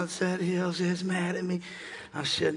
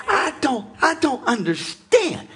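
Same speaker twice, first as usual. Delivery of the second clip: high, drawn-out puzzled protest; mock bafflement; 9 semitones higher, 6 dB louder, slower than usual. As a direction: play up not getting it, loudly. Acts out a baffled believer.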